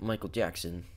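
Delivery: usual speaking voice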